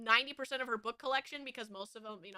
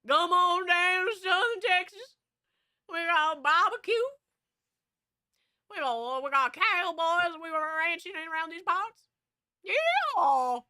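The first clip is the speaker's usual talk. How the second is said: loud, higher, drawn-out Southern cowboy drawl; hammed-up Texan accent; 5 semitones higher, 4 dB louder than usual. Streamer joking about Southern Texas, hammed-up Texan cowboy accent. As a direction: play up a Texas twang, stretching the vowels.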